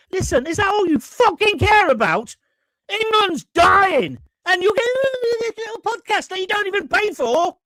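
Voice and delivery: sudden angry rant, pitch jumping up; snapping at the caller in real irritation; ratty outburst. Real, not acted. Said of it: "I just got ratty a moment ago".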